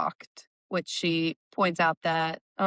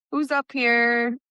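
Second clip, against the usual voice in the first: drawn-out, higher call; retold call, raised and stretched; 6 semitones higher, 4 dB louder, slower than usual. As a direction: call out slowly as if from the stairs. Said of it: "she hears Vex kind of call out from".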